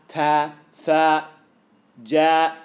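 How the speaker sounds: "ta, tha, ja" each letter said crisply and apart; letter sounds demo. Shown as a demonstration. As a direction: spell out "ta, tha, ja" slowly. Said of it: "like this".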